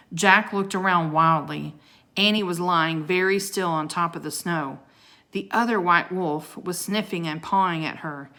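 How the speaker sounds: ordinary delivery, nothing marked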